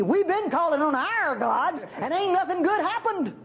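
hammed-up complaint, voice climbing to the end; complaining shipmaster. Preacher paraphrases the shipmaster to Jonah, comically complaining. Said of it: "I'd like to translate that".

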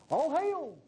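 high, stately archaic hail; mock formal greeting. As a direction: greet them in overly biblical style. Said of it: "we just don't talk like that".

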